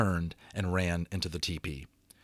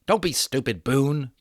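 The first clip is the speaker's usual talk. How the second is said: near shout; 4 semitones higher, 3 dB louder than usual. A narrator's reading. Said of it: "Patrick almost shouted".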